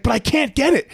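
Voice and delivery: high-pitched kid's protest, imitated. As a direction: imitate a child whining back in protest. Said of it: "don't you ever raise your voice to me".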